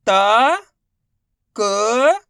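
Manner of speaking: त and क held long, one by one; drawn-out letter drill on त and क; sounding out letters. Shown as a demonstration. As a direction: stretch त, pause, then a loud क. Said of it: "एक ही अक्षर का उच्चारण करके".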